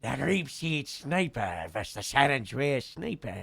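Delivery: usual speaking voice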